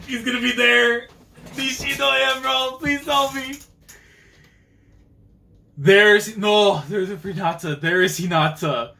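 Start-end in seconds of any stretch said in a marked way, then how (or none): 0.1-3.5 high, sustained fan wail; high-pitched, overcome whine; overwhelmed pleading. Real, not acted. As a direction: plead as if you can't take it. Overcome on spotting characters in manga.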